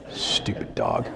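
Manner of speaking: whispered stupid dog; soft whispered aside. Real, not acted. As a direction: mutter it under your breath.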